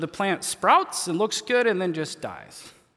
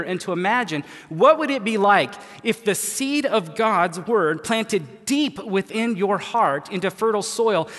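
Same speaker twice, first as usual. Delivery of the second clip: building, louder and higher, to punched short phrases; impassioned preaching; 4 semitones higher, 5 dB louder than usual. Real, not acted. Preacher inspiring listeners to imagine God's harvest, impassioned preaching. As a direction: preach it with rising conviction, then land the payoff.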